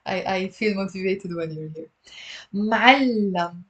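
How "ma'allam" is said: "Ma'allam" with a long, held double l; two-syllable "Ma'allam", the ll drawn out; careful pronunciation demo. Shown as a demonstration. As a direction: say "Ma'allam" slowly in two beats, dwelling on the l. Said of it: "You pronounce it".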